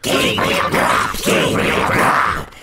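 harsh, slow screamed chant; wild voices. A narrator's reading. Wild voices taunt the sexton, harsh screaming. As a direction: scream the name out like a wild chorus. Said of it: "exclaimed the wild voices again".

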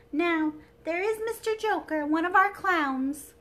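high, loud dainty princess; a delicate china princess, high and bright; sweet airy princess voice. Acted.

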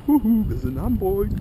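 high, bright sing-song hello; excited greeting. Real, not acted. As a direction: call out a cheerful hello. Cheery hello to the camera.

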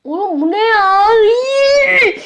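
high startled squeal; shrieking surprise. Real, not acted. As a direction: yelp in shock.

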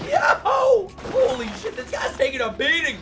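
very high-pitched astonished outburst; high excited holler, easing off; amazed shouting. Real, not acted. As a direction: yelp it in disbelief. Amazed at the beating.

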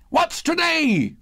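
very high, drawn-out cry; calling down. A narrator's reading. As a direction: call it out eagerly to someone below. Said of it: "cried Scrooge, calling downward to a boy".

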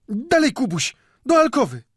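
caretaker woman's high, loud urging; urgent shouting. A narrator's reading. Caretaker woman urges old man out of sight, urgent shouting. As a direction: cry out orders at high pitch. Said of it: "krzyknęła od progu stróżka".

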